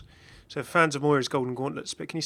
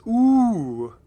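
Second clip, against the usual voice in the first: slow, loud drawn-out ooh; thrilled gasp at a spooky skin; excited ooh; 9 semitones higher, 12 dB louder than usual. Real, not acted. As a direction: let the delight burst out. Reacting to a new skin.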